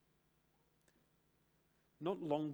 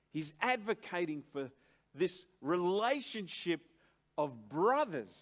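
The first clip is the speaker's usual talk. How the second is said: loud, raised, insistent sermon delivery; preaching at full force; 5 semitones higher, 8 dB louder than usual. Acted. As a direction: drive the definition home from the pulpit. Preacher climax on brothers.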